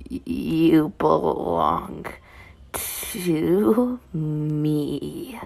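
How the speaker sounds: slow, hushed possessive growl. Acted. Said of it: "he growls".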